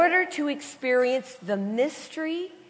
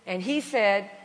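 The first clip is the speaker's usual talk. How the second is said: usual speaking voice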